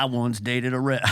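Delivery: usual speaking voice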